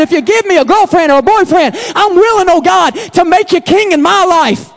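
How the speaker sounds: loud, driving preacher cadence; loud rhythmic pulpit delivery; preaching at full force. Acted. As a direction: press the point home to the church. Preacher builds the message.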